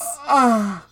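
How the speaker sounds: high, loud seagull squawk. Acted. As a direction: squawk it out like a gull. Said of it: "That was my impression of a seagull coming".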